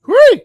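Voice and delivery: high, loud whoo from the ginger burn; a loud, high yelp after fiery ginger beer. Real, not acted. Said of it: "yeah, that was intense".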